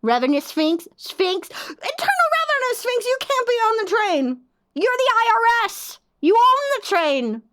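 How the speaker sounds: high indignant yelling; mock outrage. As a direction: yell up at them, incredulous. Yelling at chat user.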